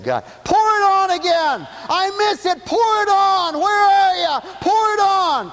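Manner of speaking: high, loud pleading cry; crying out to God. Retold or imitated. Pastor retelling his cry to God, loud and pleading. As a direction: beg God to pour it on. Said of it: "I was crying out for God".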